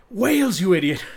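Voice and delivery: a sudden high, loud snap at the barman; mock angry correction. Scotsman in the pub joke snaps at the barman, high and loud.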